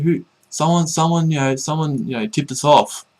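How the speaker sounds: imitating the lurers in a fluster; mock panicky accusation.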